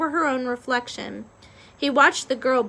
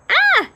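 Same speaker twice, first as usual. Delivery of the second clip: very high, loud scream; loud high shriek; 10 dB louder than usual. A narrator's reading. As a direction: scream back in fright. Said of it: "he screamed again".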